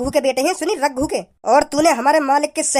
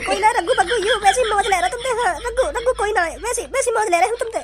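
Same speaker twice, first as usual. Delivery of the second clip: high-pitched, light character voice; placating sidekick; 6 semitones higher than usual. Acted. Sidekick tells Bhajji it was only teasing, high placating voice. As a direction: pipe up high to explain it away. Another figure explains away Raghu.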